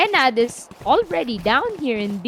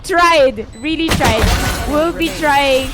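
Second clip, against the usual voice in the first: excited; 4 semitones higher, 5 dB louder, slower than usual. Real, not acted. Caster reacting to defuse attempt.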